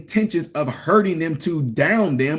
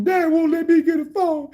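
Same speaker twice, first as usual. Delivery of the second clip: higher whiny kid imitation; a lightly voiced upset son; whining child; 12 semitones higher than usual. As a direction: mimic a complaining boy crying to mom. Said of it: "went to your mom crying".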